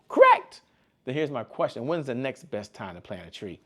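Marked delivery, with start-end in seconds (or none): 0.1-0.6 high, loud, triumphant Correct; excited shout. Acted. Coach confirming the mentor's riddle answer, in an excited shout. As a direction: burst out with a much higher, louder yes.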